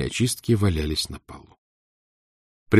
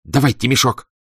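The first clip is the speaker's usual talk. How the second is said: crisp firm; 5 semitones higher, 5 dB louder than usual. Acted. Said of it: "отчеканила".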